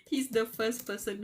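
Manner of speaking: laughing while gushing; real giddy gushing; happy laughing. Real, not acted. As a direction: gush through a laugh. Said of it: "I'm just so happy".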